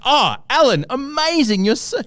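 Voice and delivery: high, fawning gush; gushing fan voice. Retold or imitated. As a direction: gush over him like a starstruck fan. Imitating gushing lefties.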